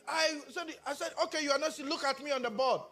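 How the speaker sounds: ordinary talk, nothing marked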